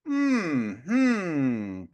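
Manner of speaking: long, falling "hmm"; curious hum. Shown as a demonstration. Man showing how to meet anger with curiosity, curious hum. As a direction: let a curious hum slide downward. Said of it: "I need to get curious".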